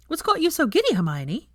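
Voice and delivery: put-on high, playful girlfriend voice teasing; teasing Veronica. Veronica teasing Hermione across the table, playful high voice. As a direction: poke fun at her friend.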